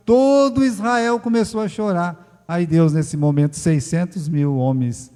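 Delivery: loud, high, impassioned sermon delivery; fiery preaching. Acted. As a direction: thunder it from the pulpit. Preaching at full force.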